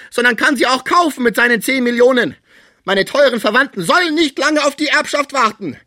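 loud, very high, bitterly ironic tirade; bitter irony. A narrator's reading. Sick man accusing relatives of valuing his treasures, bitter ironic outburst. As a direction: rail with biting irony, pitched far up. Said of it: "sagte er mit tiefer Ironie".

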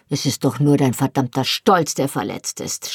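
ordinary delivery

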